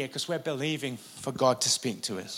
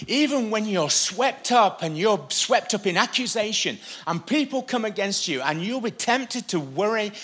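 high-pitched, urgent sermon delivery; impassioned preacher in full flow; preaching; 6 semitones higher, 3 dB louder than usual. Real, not acted. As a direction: press each phrase home with raised intensity. Preaching with raised voice.

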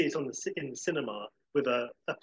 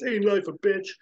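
quoting a character; 6 semitones higher, 5 dB louder than usual. Retold or imitated. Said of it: "and he says".

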